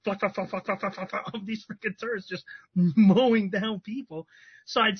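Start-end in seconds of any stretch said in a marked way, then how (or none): 0.1-1.3 rapid flak flak mimicking turret fire; mouth-made turret fire; imitated gunfire. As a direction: rattle it out like guns firing. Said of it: "All you hear is, like".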